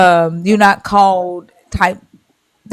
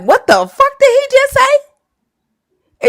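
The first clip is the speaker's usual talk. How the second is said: shocked disbelief; much slower than usual. Retold or imitated. Followers shocked at flesh and blood.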